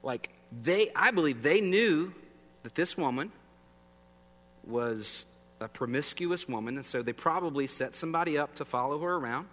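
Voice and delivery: normal talking voice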